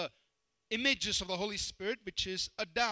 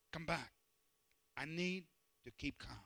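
ordinary talk

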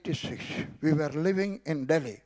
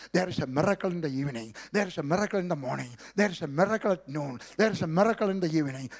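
quick, sing-song faith chant; wife reassuring the family, quick rhythmic chant; rapid rhythmic declaring; much faster than usual. Retold or imitated.

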